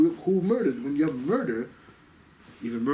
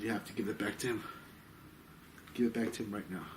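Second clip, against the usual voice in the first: low, soft, controlled command; understated authority, voice dropped; quiet firm order; 5 semitones lower, 10 dB quieter than usual. Retold or imitated. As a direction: tell them quietly, leaving no argument. Acted rabbi's firm demand for return.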